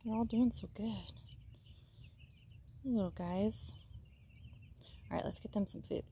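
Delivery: soft unvoiced whisper. Real, not acted. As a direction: hush it to the little chicks. Whispering near sleeping chicks.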